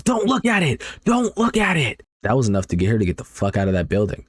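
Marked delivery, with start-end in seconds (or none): 0.0-2.0 very high-pitched repeated yell. Acted. Possessed girl yells as friend backs away, yelling. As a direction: drive her away with a high cry. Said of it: "In Young just yells".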